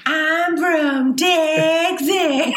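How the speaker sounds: very slow, high, loud Texan drawl; hammed-up cartoon Texan shout, laughing; Texan Sandy impression. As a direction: holler it like Sandy from SpongeBob. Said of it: "Sandy did the Texas episode".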